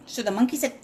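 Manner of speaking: ordinary delivery, nothing marked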